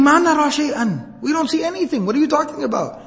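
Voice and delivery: high-pitched puzzled protest; baffled family. Acted. Family baffled by screaming man.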